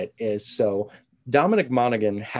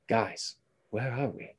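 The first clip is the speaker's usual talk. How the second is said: slow, softer line reading in a hint of British accent; Monaghan impression; 9 dB quieter than usual. Quoting a TV castaway's act-ending line to a co-host, accented impression. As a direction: do a quiet, accented actor's line, drawn out. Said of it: "doing a little Monaghan".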